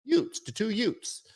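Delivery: put-on high, hammy Brooklyn accent. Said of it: "terrible, uh, Brooklyn accent I just did".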